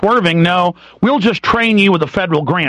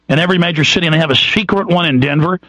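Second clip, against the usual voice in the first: ordinary delivery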